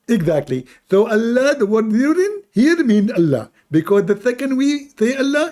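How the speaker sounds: high, loud mocking caller voice. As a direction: raise the pitch and lampoon the caller. Imitating a caller arguing.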